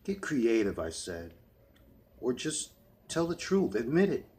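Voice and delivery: high, loud furious demand; angry shout. Acted. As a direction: shout it down at her. Gunman confronting cheating girlfriend.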